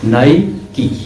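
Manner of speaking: नाइकी with a long final ee; नाइकी, not nike; Nike as nai-kee; brand name pronounced. Shown as a demonstration. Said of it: "ना ही नाइक पढ़ना है".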